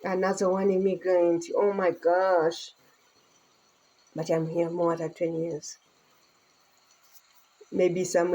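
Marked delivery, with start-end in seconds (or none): 0.0-2.7 dismissive people. Retold or imitated. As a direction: imitate people grumbling about another immigrant. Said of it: "people say".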